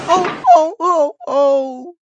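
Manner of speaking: high, loud squealing cries; screaming freight cars. A narrator's reading. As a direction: shriek like startled freight cars. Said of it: "they cried again".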